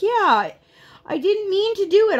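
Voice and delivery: put-on very high little-girl voice; squeaky-high mock kid agreement; daughter agreeing. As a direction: imitate a small child going along with mom. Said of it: "And she's like".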